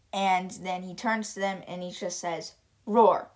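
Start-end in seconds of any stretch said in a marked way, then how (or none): 2.9-3.3 deadpan word roar, not a real roar; flatly saying roar. Retold or imitated. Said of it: "he just said the word roar".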